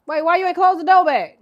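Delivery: loud, high call across the house; real yell. Real, not acted. Yelling at kids about door.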